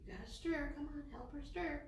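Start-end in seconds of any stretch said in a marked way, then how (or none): none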